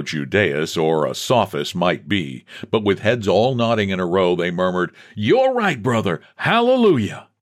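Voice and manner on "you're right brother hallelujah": high, devout congregational response; high amen-corner voice; agreeing worshippers. Acted. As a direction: agree piously in a raised pitch.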